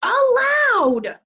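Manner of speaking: high, very slow drawn-out yell; shouted. A narrator's reading. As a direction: stretch it out in a shout. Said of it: "Eugenia shouted".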